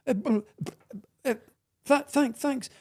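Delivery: high, stammered, stunned thanks; flustered thanks. Retold or imitated. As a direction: stumble over the thanks, caught off guard. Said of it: "I didn't know how to take it".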